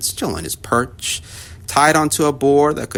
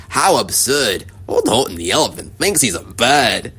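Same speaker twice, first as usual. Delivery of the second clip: mocking yell. A narrator's reading. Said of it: "they yelled".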